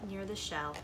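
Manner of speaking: ordinary delivery, nothing marked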